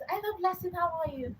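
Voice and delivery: high, light greeting voice; doctor's gentle greeting. Retold or imitated. Doctor greets the grieving mother, high and light.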